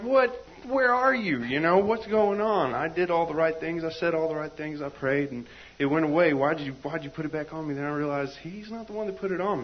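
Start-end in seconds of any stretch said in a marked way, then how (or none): 0.0-7.7 loud, high pleading protest; desperate outcry. Retold or imitated. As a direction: cry out to God, betrayed. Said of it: "And I'm like".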